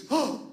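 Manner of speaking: sharp high gasp, hammed up; mock horrified gasp. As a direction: gasp like a kid losing his games. Son reacting to no TV.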